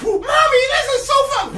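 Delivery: put-on a kid on a thrill ride; delighted baby eagle. Eaglet rides Mommy through the sky, high delighted shout. Eaglet riding on mama's back.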